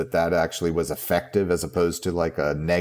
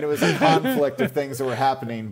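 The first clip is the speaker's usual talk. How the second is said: usual speaking voice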